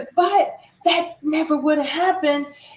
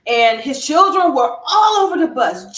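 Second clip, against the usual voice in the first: ordinary delivery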